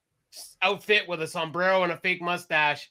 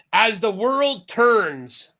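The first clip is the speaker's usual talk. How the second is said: drawn-out title; 3 semitones higher, 5 dB louder, much slower than usual. Real, not acted. Host remembering the [name] song, slow drawn-out title. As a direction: stretch the title out slowly.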